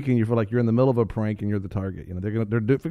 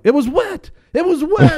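high, loud defensive wail; mock whining excuse; 8 dB louder than usual. Steve mocked as he defends his failed fire, high and loud. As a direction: squeal the excuse like a sore loser. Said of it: "Steve's going like".

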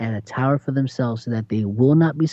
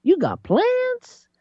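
mock cocky believer. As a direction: play the newly proud one. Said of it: "to all of a sudden".

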